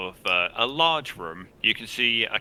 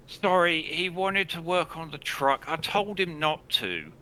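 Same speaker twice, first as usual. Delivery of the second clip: higher-pitched, put-upon sister; exasperated sister; 9 semitones higher than usual. Acted. Sister explaining her brother to guests, exasperated.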